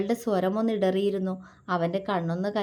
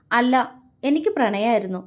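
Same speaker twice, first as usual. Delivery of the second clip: insistent, louder; stubbornly; 3 semitones higher, 5 dB louder than usual. Acted. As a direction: dig in and insist. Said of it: "അവൾ വാശിയോടെ പറഞ്ഞു".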